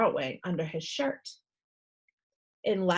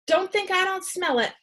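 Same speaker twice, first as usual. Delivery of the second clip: sharp stern nurse; 9 semitones higher than usual. Acted. Nurse accuses, sniffing air.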